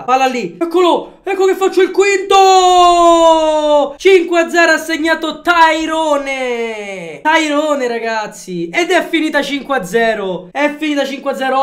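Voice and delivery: shouted, stretched play-by-play; breathless italian goal commentary. Real, not acted. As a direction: call the goal like a live commentator.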